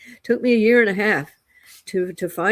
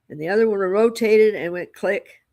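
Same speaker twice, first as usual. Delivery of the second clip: ordinary talk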